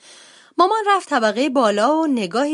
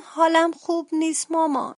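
put-on weak sick-child voice, pitched up; low-voiced, husky; 7 semitones higher than usual. A narrator's reading. Sick sister bear telling mother she feels bad, weak husky voice. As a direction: strain it small and high, as if poorly. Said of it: "با صدای آهسته و گرفته گفت".

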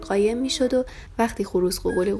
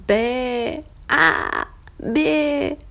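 high, louder bleating baa; ram's bleat; 4 semitones higher, 3 dB louder, much slower than usual. A narrator's reading. Ram makes silly bleats to amuse the lamb, high baa.

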